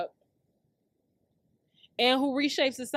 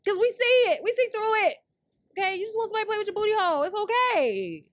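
high mocking sass; 9 semitones higher, 6 dB louder than usual. Real, not acted. Mocking men online, voice up high.

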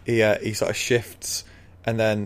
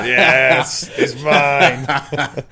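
loud, gleeful mini T-Rex; gloating claim; 4 semitones higher, 6 dB louder, much slower than usual. Acted. Juvenile T-Rex claims Big Rex's kill, gloating. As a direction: claim the prize with relish. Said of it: "like, claiming it".

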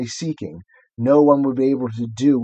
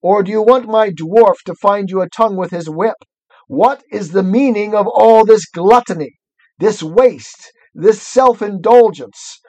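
evil queen; 7 semitones higher, 4 dB louder than usual. Acted. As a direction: scold them like a cruel queen. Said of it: "she said again".

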